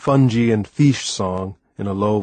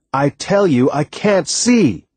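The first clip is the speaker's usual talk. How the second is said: raised and higher, stretched-out protest; angry; 5 semitones higher, 4 dB louder, slower than usual. A narrator's reading. Blind boy snapping at his stubborn teacher, angry and slow. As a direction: insist hotly, dragging out the last words. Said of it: "Becoming angry with him".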